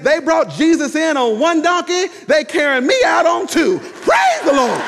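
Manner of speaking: high, loud acted church shout; triumphant holler. Mother carried out answering the ushers, triumphant holler. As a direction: holler it out, stretching the final praise.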